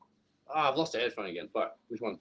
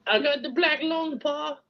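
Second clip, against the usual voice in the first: much higher, put-on sickly voice; sickly miner; 13 semitones higher, slower than usual. Sick child complaining to pa, high mock-sickly voice. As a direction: whine it weakly in a high pitch. Film quote after a put-on cough.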